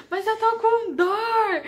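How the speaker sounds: high, drawn-out mock wail of pain; angry pain wail. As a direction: re-enact an exasperated whine, stretching it out. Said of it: "eu ficava com muita raiva". (imitando um lamento de dor com raiva, agudo e arrastado)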